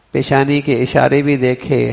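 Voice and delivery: normal talking voice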